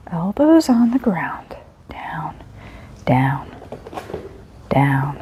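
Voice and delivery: slow, breathy whisper; whispered coaxing. Real, not acted. Trainer coaxing the dog down, whispered. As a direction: hush the dog into position.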